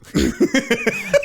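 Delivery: high squealing laugh; shrieking laugh at the friend's message; burst of laughter. Retold or imitated. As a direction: crack up hard at the text. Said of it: "And I just died laughing".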